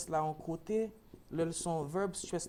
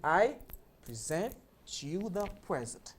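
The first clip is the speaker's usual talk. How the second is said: word by word, pri-ZENT then PREZ-ent; contrasting word stress; 3 dB louder than usual. Shown as a demonstration. Teacher models the sentence piece by piece, contrasting present and present. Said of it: "ou an fraz".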